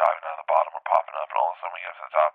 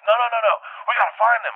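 high, loud rapid-fire refusal; urgent panic; 6 dB louder than usual. Retold or imitated. Big guy must find his brother, urgent and panicked. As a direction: cut in loud and high with alarm.

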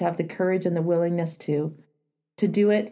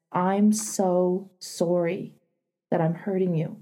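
gentle apology; 2 semitones lower, slower than usual. Shown as a demonstration. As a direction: say it tenderly, taking your time. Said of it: "you can say it like this".

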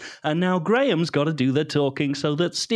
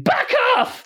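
mock scream. Quoting a scream at the dog.

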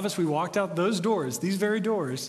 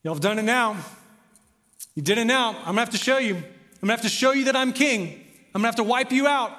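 louder, higher boastful king threatening; mock conquering king; 5 semitones higher, 4 dB louder than usual. Preacher voicing an imagined conquering king, booming boast. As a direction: swagger like a conqueror coming to crush them. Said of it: "That's not what he does".

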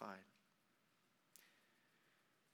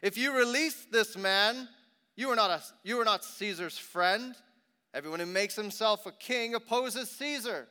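raised, loud, urgent protest; crowd crying out; 7 semitones higher, 9 dB louder than usual. A narrator's reading. Crowd pressing Pilate not to release him, loud protest. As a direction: call it out as a threat.

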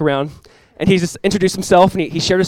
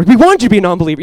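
louder, higher mock complaint; annoyed; 4 semitones higher, 8 dB louder, faster than usual. Students to the guest who fooled them, annoyed. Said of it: "even more annoyed at this point".

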